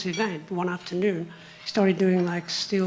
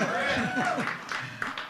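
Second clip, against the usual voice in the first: high laugh; 5 dB quieter than usual. Real, not acted. Laugh after pleading.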